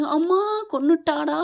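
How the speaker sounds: hammed-up hurt outcry; mock cry of pain. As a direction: yelp as if dropped on the floor. He falls and cries out.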